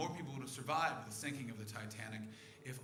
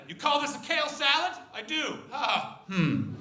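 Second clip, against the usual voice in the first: higher, louder mock quarrel voices; mock argument; 11 semitones higher, 9 dB louder than usual. Comic acting a kale salad argument, mock raised voices. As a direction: play both sides of a petty fight.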